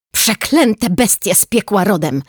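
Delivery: loud, high angry shout. A narrator's reading. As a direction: curse the rats loudly. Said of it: "krzyknęła". (gniewny krzyk)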